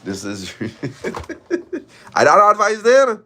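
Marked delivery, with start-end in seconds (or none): 2.1-3.2 mock loud catchphrase. Repeating a catchphrase mockingly.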